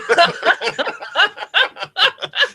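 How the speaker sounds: high helpless burst of laughter; real laugh after flubbing the line. Real, not acted. Cracking up after mixing up a quote, high burst of laughter.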